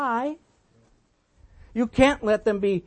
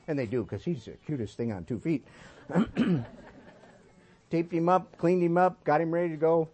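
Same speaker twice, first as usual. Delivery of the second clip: usual speaking voice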